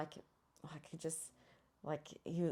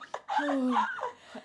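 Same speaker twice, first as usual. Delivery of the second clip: laughing sigh; 11 semitones higher, 10 dB louder than usual. Real, not acted.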